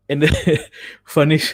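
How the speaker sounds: usual speaking voice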